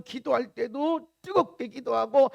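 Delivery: ordinary delivery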